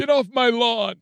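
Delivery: high, cracked mock old-man yell at kids; grumpy old man. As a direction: play the cranky old neighbour chasing kids away. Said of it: "doing an old man accent".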